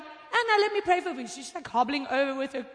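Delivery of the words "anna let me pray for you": eager offer. Retold or imitated. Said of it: "I'm like".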